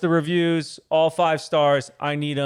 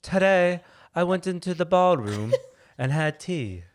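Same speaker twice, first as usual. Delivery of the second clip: giggling through it, slow; an English accent that keeps breaking into laughter; laughing English accent; 6 semitones higher, slower than usual. Shown as a demonstration. Husband tries an English accent for his wife, laughing. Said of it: "You go".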